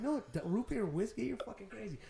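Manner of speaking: high-pitched incredulous protest; can't-believe-it reaction, imitated; retold disbelief. Retold disbelief at dad's drink.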